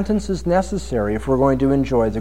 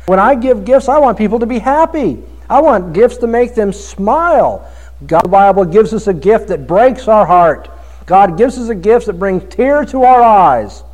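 ordinary talk

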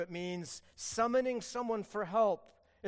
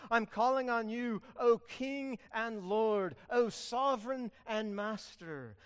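earnest plea; 5 semitones higher, 5 dB louder than usual. Acted. Psalmist's plea voiced.

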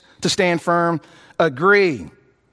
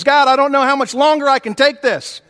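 higher, louder cry of distress; desperate plea to God; anguished prayer; 6 semitones higher, 3 dB louder than usual. Retold or imitated. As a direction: pray like someone being torn apart. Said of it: "when we're pulling apart at the seams".

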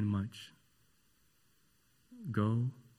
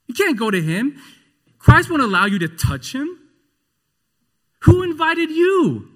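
loud, high, taunting accuser; rising, mocking voice of Satan; Satan accusing; 12 semitones higher, 12 dB louder than usual. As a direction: sneer and accuse the sinner. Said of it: "You will hear Satan say".

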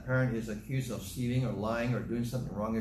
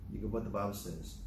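softer and faster, weight moved to "what"; quiet repeat; 4 semitones lower, 7 dB quieter, faster than usual. Shown as a demonstration. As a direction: drop the voice and rush it like a settled point.

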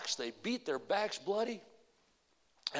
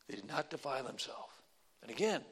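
normal talking voice

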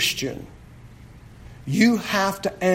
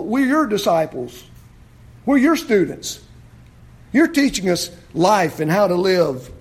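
ordinary talk, nothing marked

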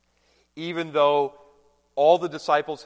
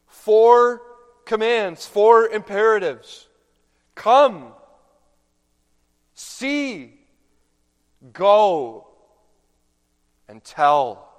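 each command hit high and loud; emphatic preaching; 5 semitones higher, 9 dB louder, slower than usual. Real, not acted. Pastor drives the four commands home to the congregation, loud and emphatic. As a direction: hammer home every word with pauses.

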